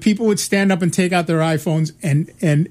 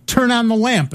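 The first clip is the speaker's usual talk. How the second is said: higher-pitched spoken command, falling at the end; user giving a command; 5 semitones higher than usual. Retold or imitated. Presenter orders early voice computer, mock command. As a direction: give the computer a firm order. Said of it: "And they would say".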